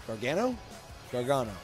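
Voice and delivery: usual speaking voice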